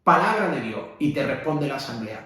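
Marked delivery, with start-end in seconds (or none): 0.0-0.8 "Palabra" proclaimed louder with a falling close; exclamation. Shown as a demonstration. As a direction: announce "Palabra de Dios" as an exclamation. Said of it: "es una exclamación". (proclamando "Palabra de Dios" como exclamación)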